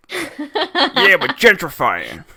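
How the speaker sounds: loud, slow troll voice; hammed-up troll, starting high; troll landlord. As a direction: bellow like a comic New York troll. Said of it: "trolls in New York City are just landlords".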